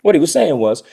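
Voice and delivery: ordinary delivery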